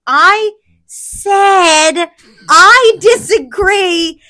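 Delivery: exasperated rant rising to a peak. Real, not acted. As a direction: argue slowly and insistently, pitch climbing. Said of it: "Don't raise your voice".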